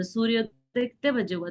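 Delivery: ordinary talk, nothing marked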